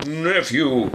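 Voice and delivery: loud, stern address. A narrator's reading. Scrooge answers his nephew sternly, loud and cold. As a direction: clip it like a warning. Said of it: "returned the uncle sternly".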